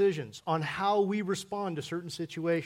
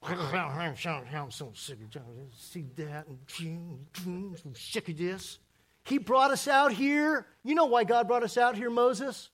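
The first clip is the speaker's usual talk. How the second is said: grumbling Hebrew. Retold or imitated. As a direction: moan it, then boil over at Moses. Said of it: "it sounds like this".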